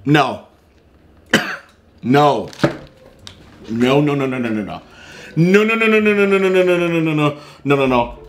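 panicked with crumbs in throat; urgent rushed repeated no; coughing on a cookie. Real, not acted. Seen 0: No chewing seen; wide-eyed coughing panic, rushes to pour milk.

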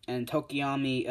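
ordinary delivery

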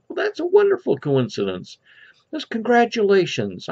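lightly voiced woman, pitched high; high storyteller's take on a pleased lady; congratulating lady. Woman congratulates man in joke.

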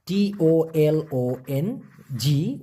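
slow letter-by-letter spelling of তোলং. Shown as a demonstration. Teacher spelling তোলং for students, slowly. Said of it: "উচ্চারণটা হবে".

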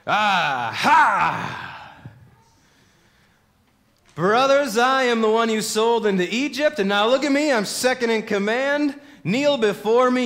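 loud, high, triumphant shouting; gloating shout. Pretend. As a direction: crow over them like a vengeful ruler. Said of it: "If you were in Joseph's position".

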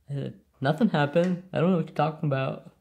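lightly voiced dog feigning innocence; quick, mock-innocent denial as the dog. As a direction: play a hint of a dog covering up. Voices dog playing innocent after mistake.

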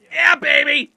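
loud, high victory shout; triumphant cheer. Real, not acted. Player lands the grab, triumphant cheer. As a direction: crow over the save.

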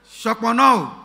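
loud outburst, re-enacting the pastor; imitated shout. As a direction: shout it out like a sudden reflex. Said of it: "So he just shouted".

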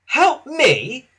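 cross, indignant question, high and loud. A narrator's reading. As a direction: throw it back, offended. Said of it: "said Thomas crossly".

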